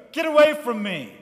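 loud, high, recoiling cry; frightened shout. Acted. God voiced like someone frightened of a snake, loud frightened shout. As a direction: shove the snake away in fright. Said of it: "Like a person who's frightened of a snake".

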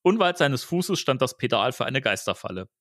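ordinary delivery, nothing marked

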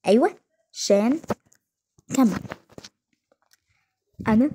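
ordinary talk, nothing marked

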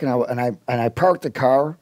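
ordinary delivery

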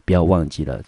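ordinary talk

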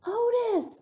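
high, loud call of a name; high carrying shout; hollered. A narrator's reading. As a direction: holler to someone across the rain. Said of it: "I hollered at him".

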